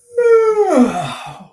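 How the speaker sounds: loud, high exasperated sigh. Real, not acted. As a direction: sigh out all the frustration. Exasperated at the date's story.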